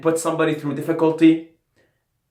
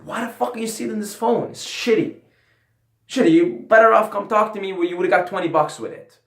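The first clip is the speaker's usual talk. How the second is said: higher, faster exasperated outburst at the thief; mock outrage; 8 semitones higher, 4 dB louder than usual. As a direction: throw the question at him, incredulous. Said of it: "It's like".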